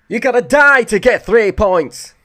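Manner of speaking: high, loud, slow bad northern English shout; terrible Neil Warnock impression. Mimicking his favourite manager's rant for viewers, a loud bad northern accent. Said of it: "That was awful ... terrible accent".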